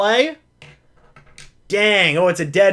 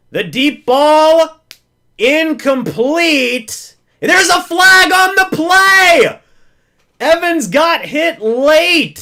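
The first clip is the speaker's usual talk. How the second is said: excited shout; 8 semitones higher, 6 dB louder, slower than usual. Real, not acted. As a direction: belt out the flag call as the play unfolds. Deep ball on fourth down.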